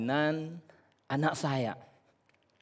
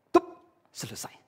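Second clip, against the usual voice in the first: ordinary delivery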